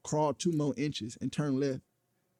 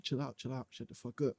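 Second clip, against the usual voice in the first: hushed; 6 dB quieter than usual. Retold or imitated. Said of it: "I was like".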